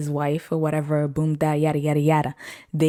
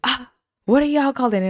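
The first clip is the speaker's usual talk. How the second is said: eager question; 8 semitones higher than usual. Retold or imitated. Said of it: "He was like".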